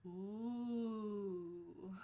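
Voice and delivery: drawn-out teasing ooh; mock crowd ooh. Cafeteria students reacting to the threat, teasing ooh. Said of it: "everybody goes".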